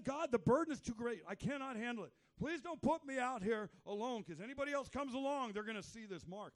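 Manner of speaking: loud, high desperate plea; pleading Cain. Retold or imitated. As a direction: beg God for mercy. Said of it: "he said".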